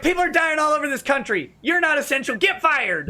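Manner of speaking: high-pitched mock lecture to the scammer; scolding him like a preacher; mock outrage. Retold yelling at scam caller.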